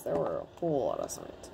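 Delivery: low quiet self-correction; low muttered correction. Real, not acted. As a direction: mutter the fix under your breath. Corrects own grammar, repeating line.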